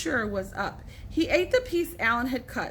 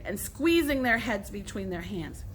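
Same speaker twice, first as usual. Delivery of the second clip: ordinary delivery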